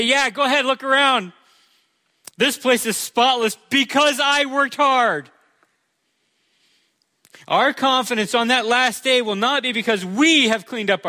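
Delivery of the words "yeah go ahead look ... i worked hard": higher-pitched, smug self-congratulation; smug boast. Pretend. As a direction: show off your spotless house to the King. Imagined proud person boasting.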